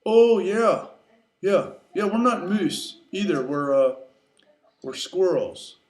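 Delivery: loud, humoring moose voice; moose playing along. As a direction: go along with it, hesitating over the lie. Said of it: "decided to play along".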